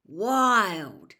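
loud, drawn-out wow; amazed. A narrator's reading. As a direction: stretch it out loudly in wonder. Ron marvels through binoculars.

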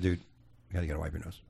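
low, hushed mock aside; discreet aside. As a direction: tip off a colleague under your breath. Discreetly warns colleague in retold scene.

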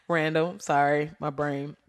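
ordinary delivery

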